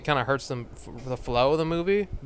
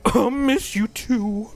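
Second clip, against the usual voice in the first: slow mock tender Woody; 7 semitones higher, slower than usual. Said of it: "Woody's like".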